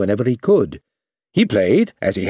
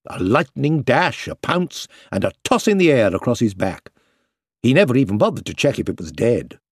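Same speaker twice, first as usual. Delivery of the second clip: plain reading voice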